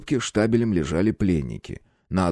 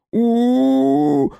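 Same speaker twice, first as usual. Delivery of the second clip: loud high muffled howl; gagged howl; 5 dB louder than usual. A narrator's reading. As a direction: wail through a gag. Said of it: "взвыл пленник".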